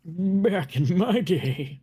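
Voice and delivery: put-on high, very slow creaky old-timer voice; old man. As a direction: drag it out like a grumpy grandpa. Mocking old people nostalgia.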